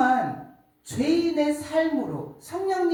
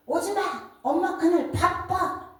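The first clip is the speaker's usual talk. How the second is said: sharp, high shouted refusal; retold shout; 9 semitones higher than usual. Sister shouting over the phone at mom: high mock yell. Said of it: "이렇게 소리를 치는 거예요".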